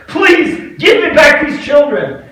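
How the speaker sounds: slow, high, loud plea; begging shout. Retold or imitated. Rabbi begging the Pope for the children, loud slow plea. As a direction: draw out the begging cry. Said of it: "I pleaded with him".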